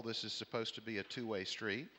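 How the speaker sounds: ordinary talk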